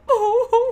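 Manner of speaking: high, loud mock sob; hammed-up high-pitched bawl; imitated crying wail. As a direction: wail it out in a comic imitation cry. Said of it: "you hear him go".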